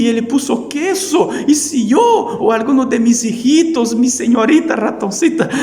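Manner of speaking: high, loud squeaky pleading mouse voice; desperate mouse. Acted. Preacher as desperate mouse begging the hen, high and pleading. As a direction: beg the hen for help, high and frantic. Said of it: "fue a conversar con la señora Galina y dice".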